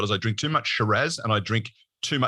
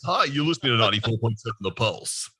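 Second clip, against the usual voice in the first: radio voice. Shown as a demonstration. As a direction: do your very best radio station ident. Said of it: "put on my very best radio voice".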